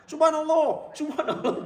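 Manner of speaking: high, laughing subhanallah; mocking congregation, laughing. Congregation correcting the imam, high and laughing. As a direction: mimic the confused congregation through a laugh. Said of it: "Kata makmum".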